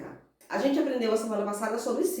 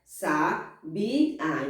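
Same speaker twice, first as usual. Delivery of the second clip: "Sabiá" as sa, bi, á with gaps; separated syllables; 4 semitones lower than usual. Shown as a demonstration. Teacher shows how the word separates, syllable by syllable. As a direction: split "Sabiá" into three separate beats. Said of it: "Que a gente separa".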